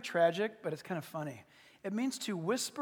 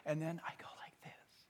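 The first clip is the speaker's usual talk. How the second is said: very soft, unvoiced whisper; demonstrated whisper; 6 semitones lower, 18 dB quieter than usual. Shown as a demonstration. Preacher shows how whispering draws attention, hushed whisper. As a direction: drop suddenly to a barely audible whisper. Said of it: "if I start telling you like this".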